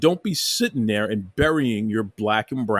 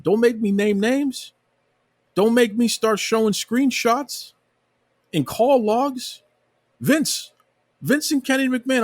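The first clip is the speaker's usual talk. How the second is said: rising, heated, higher-pitched warning; climbing higher with each threat; worked up; 9 semitones higher than usual. Real, not acted. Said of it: "Got me all worked up".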